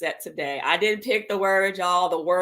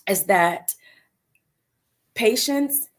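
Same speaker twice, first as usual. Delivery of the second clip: usual speaking voice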